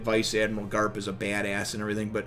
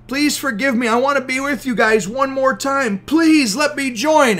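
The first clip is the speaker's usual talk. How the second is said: high tearful begging; 12 semitones higher, 7 dB louder than usual. Retold or imitated. Usopp begging the crew to let him join, high sobbing plea. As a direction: plead through tears to rejoin.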